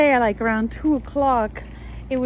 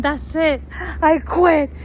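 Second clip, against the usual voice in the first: higher, louder mock-tearful giving up; retold tearful outburst; 4 semitones higher, 3 dB louder than usual. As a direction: act out throwing in the towel in tears. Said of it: "I was just crying, saying".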